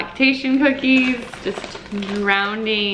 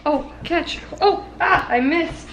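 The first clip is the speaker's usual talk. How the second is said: playful; 7 semitones higher than usual. Real, not acted. Playing catch with toddler.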